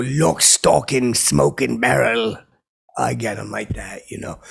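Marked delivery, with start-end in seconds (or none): 0.0-2.4 slow, loud, spaced punchline delivery; slow loud movie-title punchline. Retold or imitated. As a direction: drag each word out loud. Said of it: "I go".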